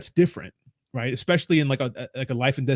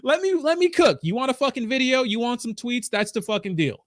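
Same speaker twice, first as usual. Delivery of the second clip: high-pitched exasperated retort fading down; high irritated mock rant cooling off; 8 semitones higher than usual. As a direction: snap back at the dev, then settle. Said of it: "It's like".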